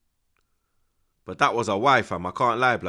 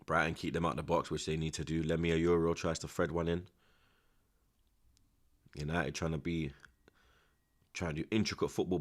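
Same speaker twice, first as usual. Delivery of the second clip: hushed play-by-play, much softer; whispered commentary; 6 semitones lower, 9 dB quieter than usual. Acted.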